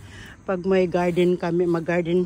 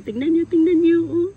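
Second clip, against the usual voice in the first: higher and louder, bursting with joy; thrilled gardener showing off her squash; excited; 10 semitones higher, 7 dB louder than usual. Real, not acted. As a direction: call out to the viewers in delight. Shows off her first squash.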